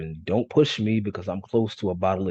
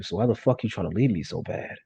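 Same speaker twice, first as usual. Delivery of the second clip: ordinary delivery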